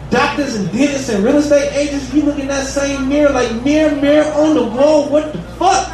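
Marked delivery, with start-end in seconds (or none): none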